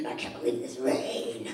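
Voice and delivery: low, whispery whining ex; mock crabby grumbling; whiny ex. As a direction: grumble it under the breath, sulking.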